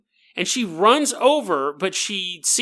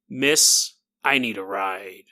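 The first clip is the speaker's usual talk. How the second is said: ominous hitchhiker; 12 semitones lower, slower than usual. Acted. Menacing hitchhiker in a ghost story.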